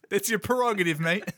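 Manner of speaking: mock-offended, with laughter breaking in; indignant lizard character. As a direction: protest in a high, wounded character voice. Plays lizard character arguing with Willie.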